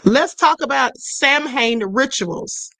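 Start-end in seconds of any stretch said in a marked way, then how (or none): 1.0-1.8 "Samhain" said as SAM-hain; mispronounced by spelling. Real, not acted. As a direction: read "Samhain" as spelt, SAM then HAIN. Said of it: "I'm saying it wrong".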